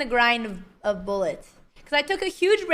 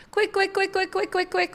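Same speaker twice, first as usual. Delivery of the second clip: frantic repeating; 4 semitones higher than usual. Real, not acted. Chanting while rushing moves.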